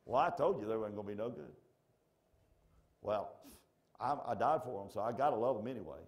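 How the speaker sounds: fast, loud grumbling complaint; bickering. Acted. God, Jesus and Holy Spirit imagined squabbling, mock bickering. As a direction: fuss back and forth in quick, testy bursts. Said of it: "they're bickering and fussing and fighting".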